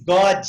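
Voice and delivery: "God" said as "God-ee"; added final vowel. Shown as a demonstration. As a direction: put an extra ee sound after the d of "God". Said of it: "esse I no final não vai". ("God" com "i" no final, "Gódi")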